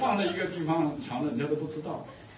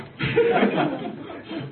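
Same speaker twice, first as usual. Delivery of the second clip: high laughter; 13 semitones higher, 4 dB louder than usual. Real, not acted. Laughing at own story.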